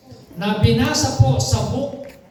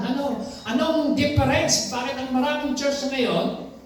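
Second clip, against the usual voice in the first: normal talking voice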